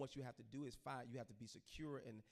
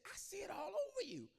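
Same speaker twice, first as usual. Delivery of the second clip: high, urgent, strained voice; see something terrible on him; mother warning her son in a high alarmed voice; prophetic mother; 6 dB louder than usual. Retold or imitated. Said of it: "She said".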